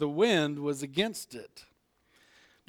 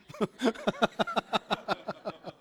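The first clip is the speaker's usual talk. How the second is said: amused chuckle; 5 semitones higher than usual. Real, not acted. Laughs at own joke.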